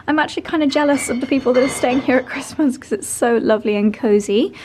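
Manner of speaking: usual speaking voice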